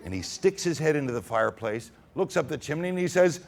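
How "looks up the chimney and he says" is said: ordinary delivery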